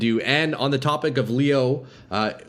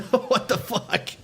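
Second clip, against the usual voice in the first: laughing; 11 semitones higher, slower than usual. Real, not acted. Laughing after near death.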